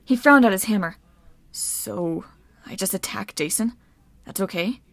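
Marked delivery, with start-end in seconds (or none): 1.5-4.8 soft, foggy questioning; hushed, bewildered murmur; dazed mumble. A narrator's reading. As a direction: ask quietly as if in a trance. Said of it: "in a daze".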